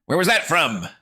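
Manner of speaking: loud, high startled yell. Acted. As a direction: yell it over your shoulder. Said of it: "I yelled".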